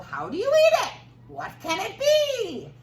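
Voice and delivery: very high, loud squeaky wonder; puzzled townspeople. Acted. Townspeople baffled by the strange food, very high and loud.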